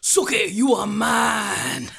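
slow, drawn-out seductive vampire claim; Vampire Bill impression. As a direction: drag it out like a brooding vampire. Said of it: "That's my impression of Vampire Bill".

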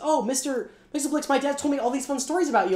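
put-on high, delighted little boy voice; a boy recognizing the imp from bedtime stories, high and delighted; excited kid. Son recalls dad's bedtime stories.

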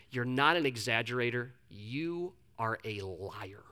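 low imitated mentor, dropping soft and very slow; stone-cold verdict. Mentor corrects the confessing preacher, stone-cold and deliberate. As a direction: deliver the blow slowly, low and stony. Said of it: "the most stone".

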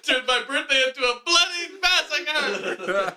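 high, slow, laughing through it; giggling mock dramatic line.

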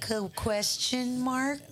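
exaggerated hesitant asking on "question mark"; mock questioning. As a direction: draw out "question mark", teasing his uncertainty. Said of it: "now he's like".

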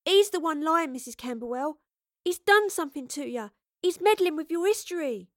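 high-pitched, insistent plea; heated, desperate warning; urgent accusation. A narrator's reading. Astrid pleads against the accuser.

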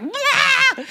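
loud, high bawling burst; mock crying wail. Preacher retelling her breakdown to a friend, mock crying wail. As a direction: burst into a comic, high-pitched sob. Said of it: "And I start crying".

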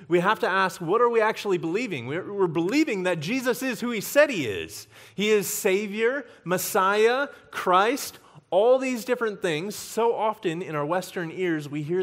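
preacher building to a loud climax; preaching at full force. Acted.

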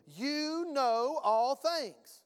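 emphatic, stretched-out sermon declaration; forceful preaching. Real, not acted. Preacher assuring congregation members they have an unction, forceful and drawn out. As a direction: drive each key word into the congregation.

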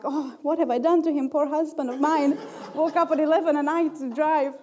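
mock lament. She mocks her own guilt over her husband, laughing.

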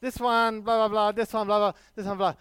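hammed-up Chinese accent, upbeat street seller. Street vendor reassuring vegetarian customer, mock Chinese accent. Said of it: "if I do a Chinese accent".

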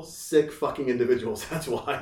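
normal talking voice

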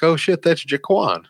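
mock surprise. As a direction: act out spotting a friend on the news. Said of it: "you're like".

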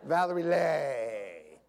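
long drawn-out Southern vowel on Lee; exaggerated Southern drawl. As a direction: drag the name out, Southern style. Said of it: "People from the South, they don't, when they talk".

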